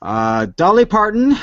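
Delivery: normal talking voice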